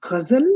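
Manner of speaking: "Ghazal" opening with throaty gh; Urdu guttural gh. Shown as a demonstration. Teacher citing Urdu words, guttural gh. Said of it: "See the guttural sound?".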